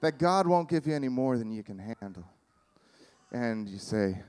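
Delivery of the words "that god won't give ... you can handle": Southern friend offering comfort, slightly lower; Southern woman. Acted. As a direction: keep up the Southern lady act. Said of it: "I'm just gonna roll with it".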